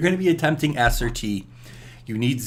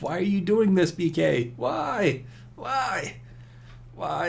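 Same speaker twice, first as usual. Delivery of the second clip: higher, whiny self-scolding; mock scolding; 6 semitones higher, slower than usual. Gamer pleading with himself, mock scolding. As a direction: plead with yourself to stop.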